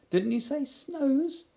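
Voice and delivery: very high, small squeaky voice; tiny piglet talking up to his friend; Piglet's squeak. Acted. Piglet correcting Pooh, tiny squeaky voice.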